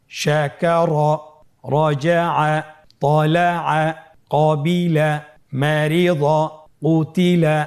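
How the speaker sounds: slow, deliberate reading of each word; whole-word reading demo. Shown as a demonstration. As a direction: model the whole word clearly for students. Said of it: "বানান ছাড়া পড়ুন".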